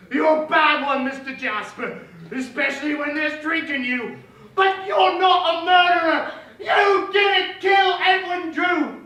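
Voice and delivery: loud high declaiming, building to long shouts; melodramatic stage character. Acted. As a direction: accuse grandly, then stretch out the final cries. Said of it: "a brief montage of every character".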